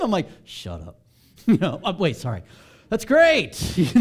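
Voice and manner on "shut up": low, soft, deadpan "shut up"; grumpy retort. Retold or imitated. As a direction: mutter it like a grumpy non-morning person. Grumpy reply to morning people.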